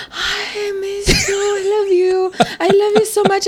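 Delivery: high, teary gushing voice; gushing mom. Retold or imitated. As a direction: imitate an overjoyed mom. Mom greeting her emotionally.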